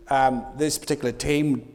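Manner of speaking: ordinary talk, nothing marked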